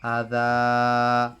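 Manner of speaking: تلاوة القرآن; Quranic recitation. Shown as a demonstration. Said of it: "recites again".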